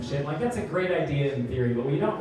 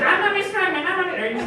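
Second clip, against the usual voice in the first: put-on high childish chant; sing-song it like a proud little boy; kid's boast; 13 semitones higher, 3 dB louder than usual. Comic imitating the boy coming back at him, high childish chant. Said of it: "Aiden is just".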